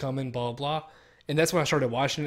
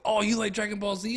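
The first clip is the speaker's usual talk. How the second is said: higher, mock-eager clerk voice; mocking clerk's question; 7 semitones higher than usual. Clerk asking a man in a Dragon Ball shirt the obvious, mock-eager and higher. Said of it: "his first question were like".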